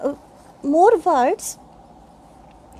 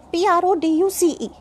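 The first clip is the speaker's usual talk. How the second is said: ordinary talk, nothing marked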